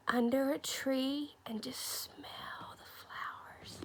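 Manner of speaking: slow, very soft whisper; dreamy whisper. Real, not acted. Teacher invites pupils to sit under a tree, whispering. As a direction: fade into a peaceful hush.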